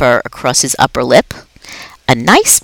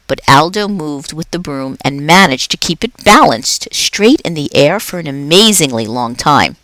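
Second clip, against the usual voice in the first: ordinary delivery, nothing marked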